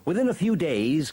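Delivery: ordinary delivery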